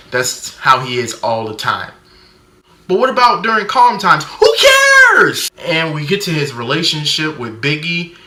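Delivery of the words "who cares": very high squeal on "Who", then a loud "cares"; exasperated yell. Acted. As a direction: yelp it out dismissively. Running dismissive gag about the movie.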